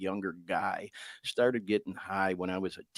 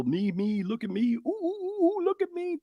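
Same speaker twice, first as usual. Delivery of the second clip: much higher, squealy mock of a social media show-off; 3 dB louder than usual. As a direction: whine it like a self-obsessed poser begging for attention. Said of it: "these masks, if you will".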